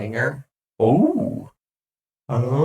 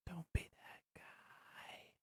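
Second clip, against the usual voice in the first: whisper; 8 semitones higher, 24 dB quieter, slower than usual. Pretend. Testing voice effects.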